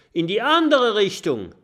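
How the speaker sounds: laut und viel höher gerufen; Schrei. Acted. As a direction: schreit die Richtung hinaus. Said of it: "schreit Kokosnuss". (Kokosnuss schreit dem Büffel zu, laut und hoch)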